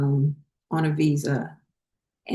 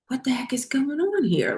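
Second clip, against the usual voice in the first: whispered, high and alarmed; a startled whisper from behind the door; 9 semitones higher than usual. Retold or imitated. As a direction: whisper it in shock, much higher. Hearing hitting through the wall.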